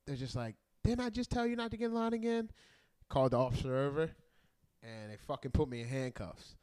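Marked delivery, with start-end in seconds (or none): 0.8-2.5 higher-pitched mock scolding; retold exasperated question, pitched up; imitated fed-up doorman. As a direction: lightly voice a doorman losing patience.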